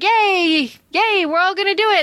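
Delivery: high-pitched, hammed-up rallying yay; mock eager cheer. One soldier starts cheering the speech, mock-eager. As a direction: cheer as the first one to join in.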